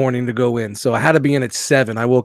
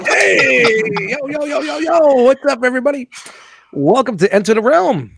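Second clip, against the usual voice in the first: hype shout; 12 semitones higher than usual. Real, not acted. Guest greeting the audience, shouting excitedly. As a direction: burst in yelling to the whole room.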